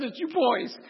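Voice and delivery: high-pitched mock tearful mom; retold crying mother. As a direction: imitate a mother blurting through tears. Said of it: "she really starts crying".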